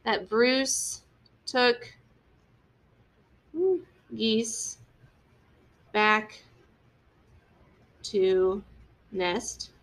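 very slow, word by word with long pauses; slow dictation. Shown as a demonstration. Teacher dictating the sentence to the class while writing, slow and word by word. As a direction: spell it out slowly as you write it on the board. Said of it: "So I'm gonna say for my first rising action".

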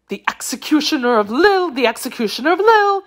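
fast, high cry climbing in panic; wild affright. A narrator's reading. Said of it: "cried her ladyship, overcome by wild affright".

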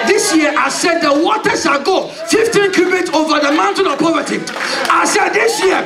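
prophesy with full force over the crowd; prophetic declaration. Acted. Preacher declaring poverty drowned to church, shouting.

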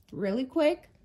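mid-sip of coffee, words round a drink. Real, not acted. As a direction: talk between sips of coffee. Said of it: "Sorry, I'm drinking coffee".

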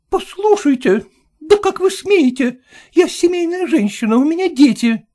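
high, loud rebuttal; indignant retort. Acted. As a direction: stand your ground firmly. Hostess outraged.

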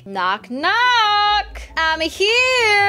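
high, bright door-knock call; singsong visitor. Pretend. Arriving at Silly's house, high singsong knock knock. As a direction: announce yourself at the door in a high voice.

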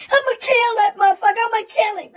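fast, loud, hammed-up threat; mock rage. The heartbroken friend vowing to kill the rival, mock rage. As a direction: spit out the revenge vow in a hurry. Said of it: "friend vows revenge".

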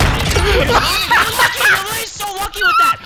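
loud, high-pitched outraged protest; exasperated shouting. Real, not acted. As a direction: yell in real frustration at a lucky opponent. Losing gamer protests opponent's luck.

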